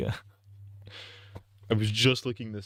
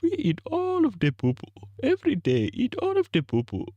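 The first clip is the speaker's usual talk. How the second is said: mock Nigerian accent; 13 semitones higher than usual. Streamer's mock Nigerian accent questioning Kwanzaa, very high.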